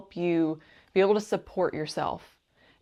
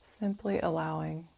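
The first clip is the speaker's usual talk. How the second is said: guided meditation; 4 dB quieter than usual. Acted. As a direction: gently guide the listener into calm, unhurried and soothing.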